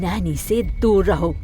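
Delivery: slow, deliberate command; stern warning. Acted. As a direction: spell out the warning slowly. Angry mother orders him off. (धीरे-धीरे सख्त चेतावनी)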